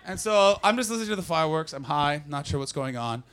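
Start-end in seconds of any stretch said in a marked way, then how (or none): none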